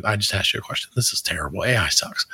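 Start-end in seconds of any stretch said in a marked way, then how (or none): none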